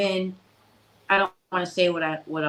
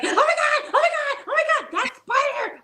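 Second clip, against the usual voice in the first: mock shrieking, much higher and fast, through laughter; Susan panicking. Teasing his co-host Susan about spiders, laughing mock shriek. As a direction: squeal in fake terror at a spider, laughing. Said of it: "Susan's like".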